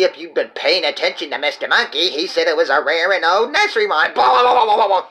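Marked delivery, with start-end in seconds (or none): 4.1-5.1 mocking blah blah. Mocking the other puppet.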